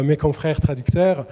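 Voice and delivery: usual speaking voice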